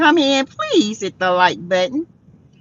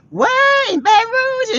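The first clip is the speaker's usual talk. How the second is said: surprised disbelief; 13 semitones higher than usual. Real, not acted. Host hearing it rains in a viewer's town, high surprised cry. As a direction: gasp at the news, pitch flying up. Surprised at rain in Baton Rouge.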